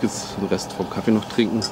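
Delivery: ordinary delivery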